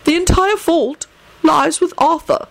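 high, decisive pronouncement; decisive old headmaster. A narrator's reading. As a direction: hand down the verdict in a lifted voice. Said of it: "Dumbledore stated decisively".

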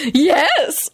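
high, wicked relish; wicked hiss. A narrator's reading. Lady confirming her affair to Richard, wickedly gloating. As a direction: say it with wicked glee. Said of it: "Lady Fanshawe hissed wickedly".

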